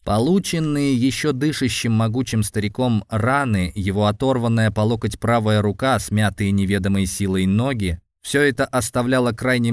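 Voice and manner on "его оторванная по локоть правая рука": ordinary delivery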